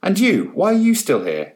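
high sharp scolding; exasperated shout. Acted. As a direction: snap at him in exasperation. Carol rounding on student.

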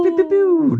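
very high mouth-made zaps; pew-pew sound effect. Pretend. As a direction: echo the laser noises way up high. Echoes the game sound effects.

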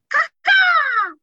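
high, loud cawing squawk; bird call. Pretend. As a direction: squawk it out like a crow. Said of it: "I'd be like".

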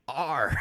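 deadpan imitation, landing hard on "Are"; clarify with one weighty "Are"; emphatic ARE. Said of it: "Wait, are or aren't?".

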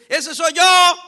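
loud, high mock cry; shouted confession. Preacher to congregation, imitating a shouted confession. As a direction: blurt it out like a confession. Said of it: "diciendo a gritos es".

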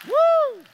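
high, loud celebratory whoop; joyful whoop. Real, not acted. Pastor whooping with joy for the saved, loud whoop. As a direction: let out a shout of praise. Shout of praise.

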